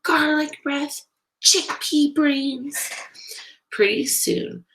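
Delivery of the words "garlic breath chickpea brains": put-on high, drawn-out kids' taunt; jeering name-calling. A narrator's reading. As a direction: call insults at the other table. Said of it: "they're calling them".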